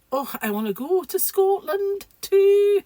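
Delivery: very high, loud, hammed-up Scottish accent; bad Scottish accent. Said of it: "They were bad".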